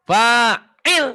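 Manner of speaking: fa'il loud and high, cut short on il; short ending. Shown as a demonstration. Teacher correcting pupil, fa'il high with short ending. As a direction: chant a long fa, then stop fa'il short. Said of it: "jadi pendek".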